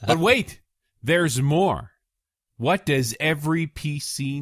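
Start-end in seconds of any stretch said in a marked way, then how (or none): 0.1-1.9 louder, higher TV-pitchman hype; infomercial catchphrase. Pretend. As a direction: hype it like a late-night ad.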